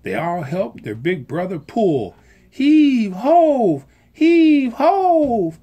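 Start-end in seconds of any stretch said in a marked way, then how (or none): 2.5-5.6 high, loud heave-ho chant; rhythmic high shouted chant; crying out. A narrator's reading. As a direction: heave together with all your strength. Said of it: "they cried".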